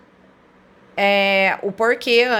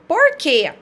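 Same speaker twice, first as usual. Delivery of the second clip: higher, louder questioning "por quê?"; acted question; 6 semitones higher, 4 dB louder than usual. Teacher voicing example dialogue for students: lightly acted question. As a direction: ask it like a puzzled friend.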